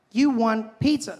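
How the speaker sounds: lightly voiced phone assistant asking back; Siri. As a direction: imitate a virtual assistant, slowly. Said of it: "She's like".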